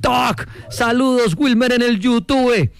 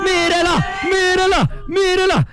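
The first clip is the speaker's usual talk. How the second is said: high, ringing repeated cries; ecstatic shouting; 8 semitones higher, slower than usual. Real, not acted. Commentator pointing out the moon to listeners, ecstatic shout. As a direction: call it out like a goal chance.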